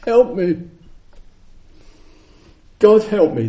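high, loud cry for help; desperate plea. Retold or imitated. Sufferer begging God for help, high and loud. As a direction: plead like someone drowning. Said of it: "They're saying".

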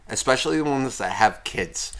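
ordinary talk, nothing marked

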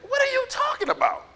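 very high, squeaky incredulous question; baffled disbelief. Acted. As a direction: act out total confusion in falsetto. Repeats baffled reply to drifters.

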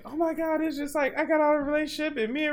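high-pitched mock woman's voice; imitating crying girlfriend. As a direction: retell her words in a high, hurried put-on voice. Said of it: "She was like".

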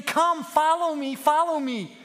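high, loud summons; calling out. A narrator's reading. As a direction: call the flock from afar. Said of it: "who was calling out to him".

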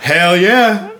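very high, loud retold victory yell; reenacted winner's holler; triumphant cheer. Repeating his triumphant answer.

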